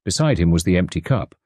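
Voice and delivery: ordinary delivery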